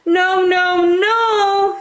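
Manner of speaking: squeaky, firm refusal; little wolves. Acted. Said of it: "said the three little wolves".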